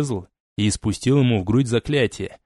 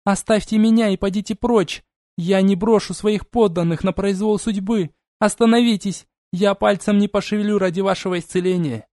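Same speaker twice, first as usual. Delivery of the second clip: desperate shouting; 9 semitones higher than usual. Acted. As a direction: struggle and rage at captors. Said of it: "вскричала она".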